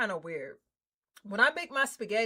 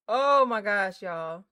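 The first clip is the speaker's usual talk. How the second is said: ordinary talk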